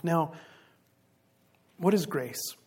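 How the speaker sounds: ordinary talk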